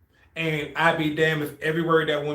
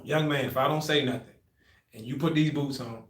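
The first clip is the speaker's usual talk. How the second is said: soft, lower, measured examiner; imitating grave warning; 4 semitones lower, 7 dB quieter than usual. Examiner warning young recruit about boots, grave. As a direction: warn quietly, as an elder to a youngster.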